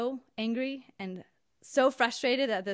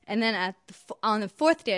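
ordinary delivery

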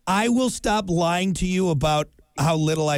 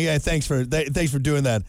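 lower, reluctant thank-you; begrudging thanks; 5 semitones lower than usual. Retold or imitated. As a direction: grudgingly thank the dentist. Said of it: "kind of a begrudging".

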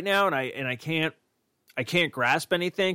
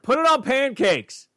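high, slow mock ad voice; sing-song slogan delivery; mock announcer; 10 semitones higher, 3 dB louder, slower than usual. Repeats name, mock ad voice.

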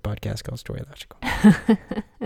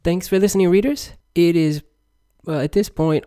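much higher, cheery outro; bright sign-off; 7 semitones higher, 4 dB louder than usual. Real, not acted. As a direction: sing it out to the listeners.

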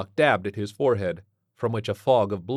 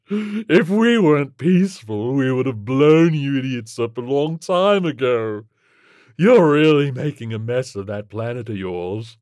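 scornful alien creature; 5 semitones higher, 9 dB louder than usual. Acted. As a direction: put the puny humans down breezily.

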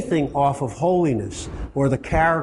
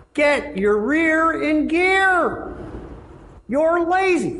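much higher, slow mock rebuke; mock scolding; 14 semitones higher, much slower than usual. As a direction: scold the lazy one in a high put-on voice. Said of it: "some people".

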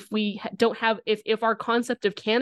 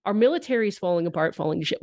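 ordinary talk

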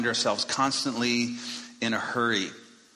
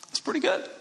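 wry high aside; 14 semitones higher than usual. Real, not acted.

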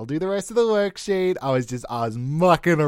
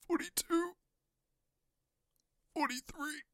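overacted death scene; 12 dB quieter than usual. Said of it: "I thought I could pretend to die".

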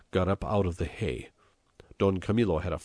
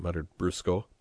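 ordinary delivery

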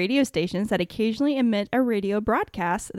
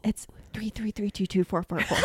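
whispered numbers voice; 5 dB quieter than usual. Acted. Numbers station impression.

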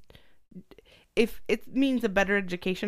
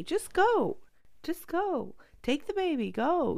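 quoting partner; 9 semitones higher, slower than usual. Retold or imitated. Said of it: "you have told me".

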